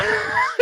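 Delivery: sudden high laugh; burst of laughter. Real, not acted. Friend cracking up telling the drowning story, high burst of laughter.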